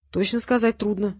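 ordinary delivery

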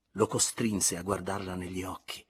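ordinary delivery, nothing marked

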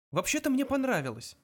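high-pitched, upbeat compliment; cheerful. A narrator's reading. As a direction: say it brightly, pitched well up. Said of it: "бодро сказал Артур".

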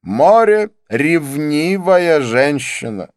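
very slow, loud, high declamation; theatrical, drawn-out pronouncement; emphatic repetition. A narrator's reading. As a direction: stretch each word out grandly. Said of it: "Повторяю".

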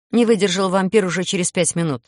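ordinary delivery

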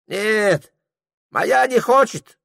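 put-on high, broken-Russian old Tungus voice; Tungus guide. Tungus greeting Prokhor in a high character voice.

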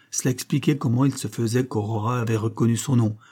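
ordinary talk

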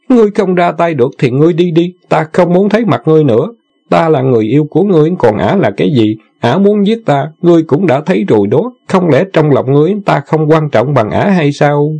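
fast, high angry tirade; furious. A narrator's reading. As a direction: rage at him, words tumbling. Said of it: "cả giận nói".

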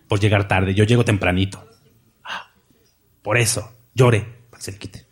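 a hint of a smug churchgoer; mock smug churchgoer. Mocks gloating churchgoer.

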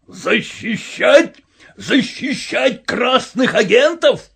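slow, high, indignant shouting; outraged. A narrator's reading. Purple-faced colonel accusing the Cossack, outraged. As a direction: stretch each word in rising fury. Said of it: "полковник с багровым лицом".

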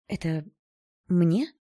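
hesitant start, then rising question; pause, then question in disbelief; disbelieving. A narrator's reading. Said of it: "недоверчиво спросила я". (недоверчиво, с удивлением)

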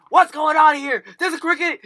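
high, very loud imitation of mom; alarmed mom shout. Mom comes out to two panicking boys, high shout. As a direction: burst in yelling like a startled parent.

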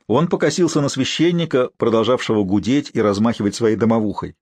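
normal talking voice

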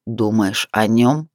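low, slow and menacing male voice; rough, seething. A narrator's reading. Man roughly confronts the woman about her rival, rough and seething. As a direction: force it out slowly, pitched well down. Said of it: "грубо процедил".

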